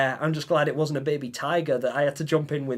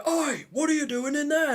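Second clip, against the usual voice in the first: much higher mock yell of an angry zookeeper; zookeeper shouting; 11 semitones higher than usual. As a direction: call out Oi across the paddock, retold. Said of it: "he's shouting at me".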